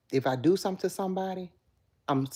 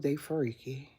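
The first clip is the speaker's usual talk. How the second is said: usual speaking voice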